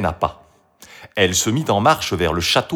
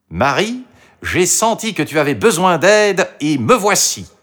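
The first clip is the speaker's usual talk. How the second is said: higher, animated fox voice; helpful fox; 8 semitones higher, 4 dB louder than usual. A narrator's reading. The fox arrives to help Marie, eager. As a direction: announce yourself brightly, pitched up.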